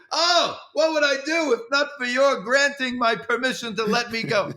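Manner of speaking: loud, high sarcastic mock gratitude; Larry. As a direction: gush thanks in a hammed-up, high impression. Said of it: "And he goes".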